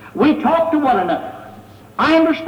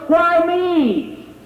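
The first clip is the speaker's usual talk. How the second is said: high, drawn-out cry of anguish; imitated scream of protest; 5 semitones higher than usual. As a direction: cry it out in pain, acted. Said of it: "he was screaming out".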